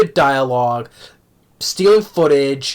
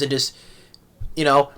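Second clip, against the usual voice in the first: ordinary talk, nothing marked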